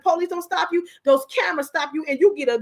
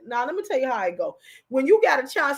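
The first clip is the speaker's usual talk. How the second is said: ordinary talk, nothing marked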